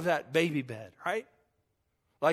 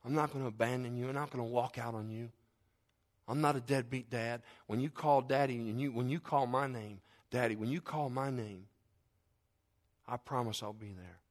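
soft fatherly promise; tender reassurance; 3 semitones lower, 6 dB quieter than usual. Acted. God as Daddy promising to stay, soft reassurance. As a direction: reassure gently, voice kept quiet. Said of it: "He'll speak into your hearts".

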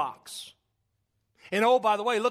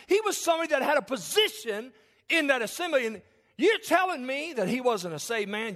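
rising, louder preacher's build; preaching at full force; 8 semitones higher, 4 dB louder than usual. Acted. Preacher stirring the congregation, rising and louder. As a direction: preach it hard, higher and louder.

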